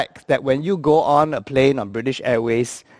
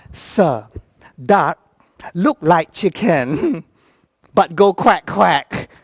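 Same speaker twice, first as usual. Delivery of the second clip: put-on halting Thai-accented English; Thai attendant explaining; much slower than usual. Attendant explaining duck to boss, slow Thai accent.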